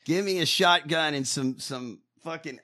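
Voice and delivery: usual speaking voice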